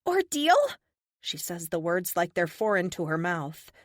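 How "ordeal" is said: high, incredulous echo of the word; a high young woman's voice, bitter; surprisingly high voice. Acted. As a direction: pitch it up high in disbelief. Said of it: "Her voice is surprisingly high".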